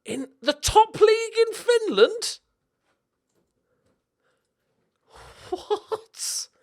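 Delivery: squeaky high astonished cry; disbelief. Real, not acted. To viewers, shocked by the Finnish league, squeaky high. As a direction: shoot way up in disbelief.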